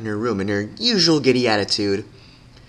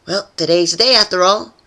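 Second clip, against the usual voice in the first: high, louder bright cheer; cheerful; 8 semitones higher, 5 dB louder than usual. A narrator's reading. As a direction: perk herself up. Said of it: "Pinkie said to herself".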